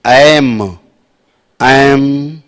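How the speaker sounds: एम, sounded with a humming closed m at the end; demonstrating the half m in एम,. Shown as a demonstration. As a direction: say एम, ending on a lips-closed hum. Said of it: "ऐसे आधा माँ है".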